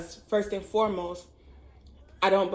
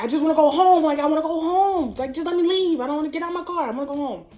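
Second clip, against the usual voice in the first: high, loud retold pleading; insistent plea; 9 semitones higher, 8 dB louder, faster than usual. Girlfriend begging her angry boyfriend to let her leave, insistent plea. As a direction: beg to be let go, raised pitch.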